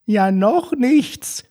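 put-on very high, fluttering old-lady voice; shrill granny calling for Jürgen; hammed-up old woman calling her husband.

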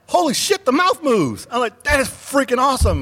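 high-pitched, gushing retold amazement; excited fanboy outburst, pitch way up; amazed. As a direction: act out being blown away by a costume. Retelling his amazement at costume.

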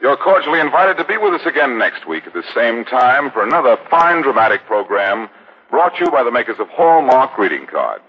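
normal talking voice

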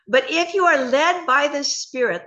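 measured, emphatic Bible reading; scripture reading. Acted.

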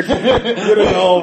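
high, slow, loud laughing shout. Real, not acted. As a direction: break up laughing. Laughing at stuttering.

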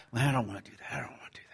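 whispering a reluctant excuse; whispered excuse. Retold or imitated. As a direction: hush it, like an inner voice dodging duty. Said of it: "we try".

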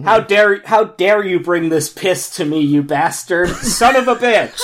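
loud, high, hammed-up American accent; mock outrage in a badly faked American accent; Doctor Strange's bad American accent. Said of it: "in his bad American accent".

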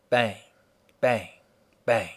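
three short, punchy mock gunshot bangs. Speaker imitates the gunshots on the tape, short punchy bangs. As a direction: spit out each bang like a shot. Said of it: "And then you hear gunshots".

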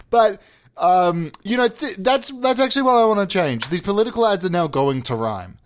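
ordinary talk

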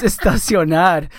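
estacionar said slowly in careful Spanish; proper Spanish word. Shown as a demonstration. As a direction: spell out estacionar in clear Spanish. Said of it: "The proper term is".

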